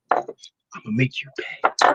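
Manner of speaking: breathy low threat; low menacing whisper. Retold or imitated. Fighter threatening his opponent face to face, whispered. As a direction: hush it like a quiet promise of pain.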